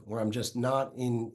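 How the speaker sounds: ordinary talk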